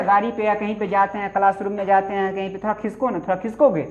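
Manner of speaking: ordinary talk, nothing marked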